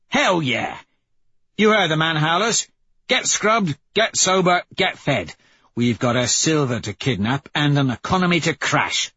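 high, excited rallying of the troops; gleeful gang leader. Acted. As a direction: fire up the crew for a mission. Plays gleeful leader rallying his crew.

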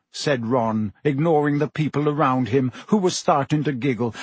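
ordinary delivery

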